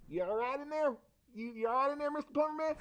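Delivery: high, soft concerned call; calling through door. Retold or imitated. Joel calling into the bathroom, high and soft. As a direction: check on him through the door.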